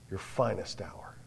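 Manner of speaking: whispered. Acted. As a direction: drop to a whisper to land it. Quiet climax of challenge.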